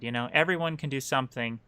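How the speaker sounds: ordinary talk, nothing marked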